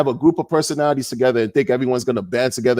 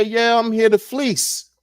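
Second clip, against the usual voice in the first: mock grifter confession; 6 semitones higher, slower than usual. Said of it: "put everything on the table and say".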